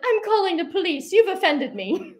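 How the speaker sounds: high-pitched affronted protest; mock offense. Tourist's wife threatening police, mock indignation. Wife's indignant response, acted quarrel.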